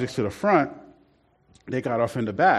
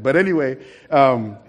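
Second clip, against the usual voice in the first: normal talking voice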